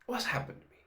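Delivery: soft, dazed repeated question; whispered dazed awe; stunned whisper. Real, not acted. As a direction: breathe it out in disbelief.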